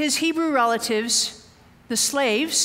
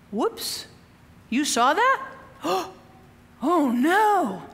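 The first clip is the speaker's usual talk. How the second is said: gasp, then a mock caught-out whoops; slower than usual. Preacher acts Moses realising his killing was seen, mock-startled gasps. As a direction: play it like a kid caught red-handed. Mock shock at being exposed.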